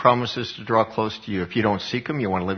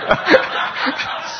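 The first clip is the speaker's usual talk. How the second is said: breathy high-pitched giggle; high laugh. Real, not acted. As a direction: burst out laughing at the memory. Laughing at his own story.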